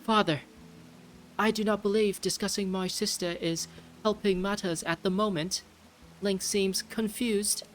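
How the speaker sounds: high-pitched put-on British prince; British accent. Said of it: "continue the accent just so I have more practice".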